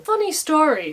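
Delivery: gleeful mocking opener; smug taunt. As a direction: relish the coming insult. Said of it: "says Kit".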